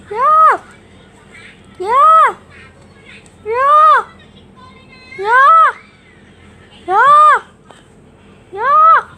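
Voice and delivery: high, loud repeated yell; loud squeaky creature cries; Yamask voice. Shown as a demonstration. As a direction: shout each yeah high and hard like a little ghost. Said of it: "Your mask will sound like this".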